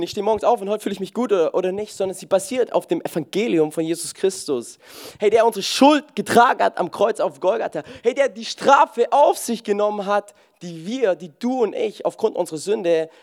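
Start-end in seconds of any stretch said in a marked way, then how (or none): none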